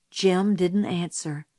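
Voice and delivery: plain reading voice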